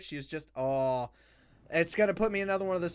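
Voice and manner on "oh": ordinary talk, nothing marked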